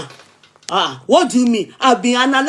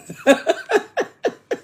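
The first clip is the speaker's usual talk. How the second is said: a real high-pitched burst of laughter; 11 semitones higher than usual. Real, not acted. Reading a cheeky comment, bursting into laughter.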